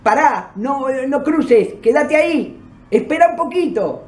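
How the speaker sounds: lightly voiced man calling out to stop; retold calling out. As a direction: hold someone back from across the street. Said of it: "el hombre que me estaba gritando dice".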